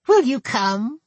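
put-on high, nasal old goblin woman; thick nasal goblin voice. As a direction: pinch it through the nose, goblin. Gertel speaks.

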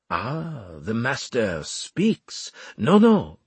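slow mock reverence, then a sharp rebuke; displeased commander with drawn-out irony; Armel, sarcastic.

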